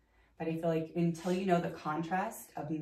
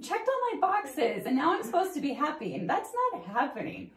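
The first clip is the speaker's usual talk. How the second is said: higher and louder, hammed-up bewildered whine; mock frustrated complaint; 10 semitones higher, 6 dB louder than usual. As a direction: play up the disbelief like a let-down kid. Retelling her own frustrated complaint.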